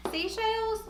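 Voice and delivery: "Seychelles" high, as see-shayls, country accent. Real, not acted. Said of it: "my country accent is really coming out".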